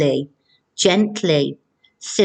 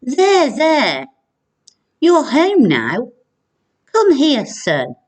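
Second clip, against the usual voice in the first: comforting dad; 7 semitones higher, slower than usual. Shown as a demonstration. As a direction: comfort a wet, cold son tenderly. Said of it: "He could be saying".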